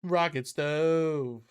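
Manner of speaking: drawn-out announcer. Pretend. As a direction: stretch each word like a hype call.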